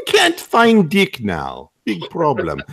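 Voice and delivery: slow, heavy Russian masseur; slow thick Russian accent. Retold or imitated. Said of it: "Russian massage therapist".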